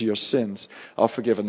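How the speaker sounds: "Your sins are forgiven" said softly and low, with tender calm; gentle forgiveness. Retold or imitated. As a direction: speak "Your sins are forgiven" as grace poured into a life. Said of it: "He looks into the soul of that man".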